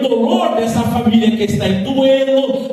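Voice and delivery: loud, ringing sermon delivery with rising intensity; full-force preaching. Acted. Pastor preaching to the church, loud and fervent. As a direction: preach it out to the congregation.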